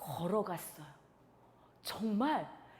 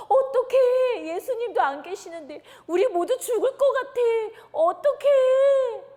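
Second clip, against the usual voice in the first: much higher and louder, sobbing panic; terrified wail; 10 semitones higher, 11 dB louder than usual. Acted. Disciples in the dark sea wailing in fear. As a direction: cry out, the boat is sinking. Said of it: "두려워하고 무서워만 하고".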